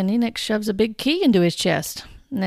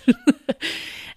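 breathy laugh; 5 semitones higher than usual. Real, not acted. Embarrassed laugh at absurd prophecy.

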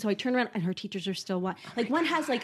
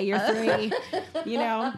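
usual speaking voice

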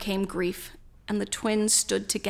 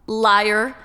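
defiant; 9 dB louder than usual. A narrator's reading. Sister defies Drew.

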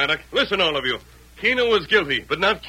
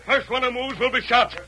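sharp high command; commanding stop; 6 semitones higher than usual. Acted. Masked man halting the mob, sharp high command. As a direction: freeze the mob with one word.

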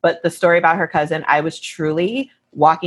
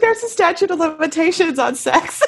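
joking; 13 semitones higher than usual. Real, not acted. Cohost joking.

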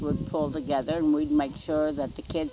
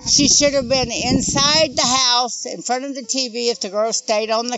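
higher and louder, indignant; heated and upset; 7 semitones higher, 5 dB louder than usual. Real, not acted. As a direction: press every point, fed up. Mother's angry recounting, raised voice.

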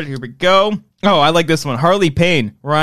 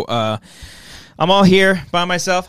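ordinary talk, nothing marked

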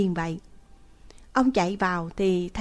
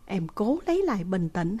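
plain reading voice

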